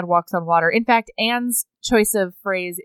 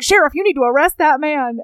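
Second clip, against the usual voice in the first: raised, louder mock outrage; higher-pitched indignant demand; 5 semitones higher, 4 dB louder than usual. Rob demands the sheriff arrest his attacker, indignant. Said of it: "all indignant".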